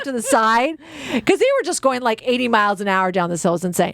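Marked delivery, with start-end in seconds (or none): none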